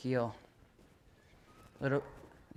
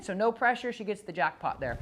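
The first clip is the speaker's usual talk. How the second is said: ordinary talk, nothing marked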